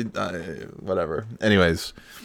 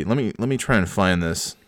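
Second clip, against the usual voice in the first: ordinary talk, nothing marked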